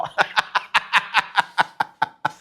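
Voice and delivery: long wheezy laugh; breathless run of laughter. Real, not acted. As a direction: crack up at your own story. Laughs at friend's retold joke.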